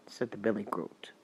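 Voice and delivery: ordinary delivery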